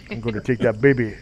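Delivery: lightly voiced tough guy, toned down; subdued echo of the thug impression; thug babysitter. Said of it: "that character is what I based Anbu on".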